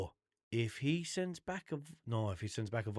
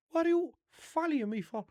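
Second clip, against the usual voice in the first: much higher, louder mock shout; imitating the worker; 5 dB louder than usual. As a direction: play the pizza guy chasing him, pitched way up. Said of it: "your man follied me out".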